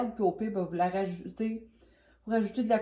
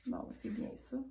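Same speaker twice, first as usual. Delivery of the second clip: whispered aside to herself; breathy under-the-breath murmur; whispering; 13 dB quieter than usual. Real, not acted. Murmuring to herself while mixing.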